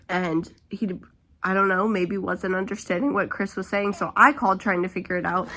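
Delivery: normal talking voice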